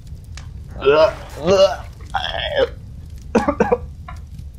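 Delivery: hammed-up rising fake cough; fake sick cough. Pretending to be sick in the car, hammed-up cough. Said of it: "My throat's hurting from doing that".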